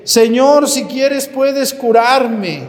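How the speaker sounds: loud, high, drawn-out plea; crying out from afar. Acted. Leper calls to Jesus from a distance, loud pleading cry. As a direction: call out slowly across a distance. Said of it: "Por eso desde lejos grita".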